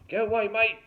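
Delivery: loud, high, hammed-up Australian shout; fake Australian accent. A narrator's reading. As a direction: bark it in a bogus Aussie accent. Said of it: "The bogus Steve Irwin accent".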